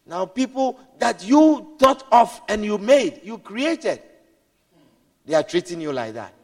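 ordinary talk

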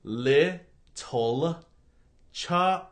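word by word with long gaps; slow, enunciated. Pretend. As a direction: spell it out painfully slowly for the listeners. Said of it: "Say it slower ... enunciate every ... word".

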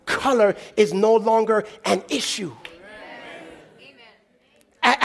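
ordinary talk

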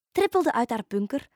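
ordinary delivery, nothing marked

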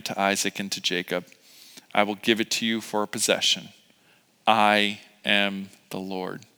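low, soft, measured reading; solemn scripture reading. A narrator's reading. Preacher reads God's promise to Israel, solemn and soft. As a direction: read God's promise quietly, with reverent pauses.

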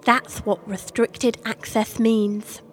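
usual speaking voice

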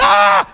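high, loud mock shriek; panicked cry. Mother shrieks when asked for moisturizer, high mock cry. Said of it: "she said".

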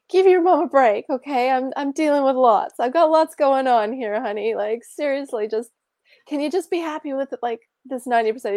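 acted frazzled mother's outburst; exasperated mom. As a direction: beg a child to give you a break.

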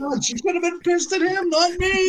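high-pitched protest. Real, not acted. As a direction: shriek it in disbelief. Protesting in disbelief.

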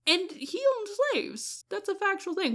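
insistent high-pitched emphasis; emphatic. Real, not acted. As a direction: spell it out firmly, pitch up.

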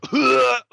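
high, loud imitated gag-cough; mock dry heave. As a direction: mimic a sudden choking heave. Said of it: "gives this big, like".

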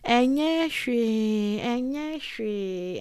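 slow, drawn-out soothing dismissal; Twi brush-off. Retold or imitated. Church elder telling you it's nothing, slow soothing dismissal. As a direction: stretch it out like an elder calming you. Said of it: "I even still hear".

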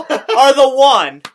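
loud laughing accusation. Real, not acted. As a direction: tease him while still laughing. Teasing co-host through laughter.

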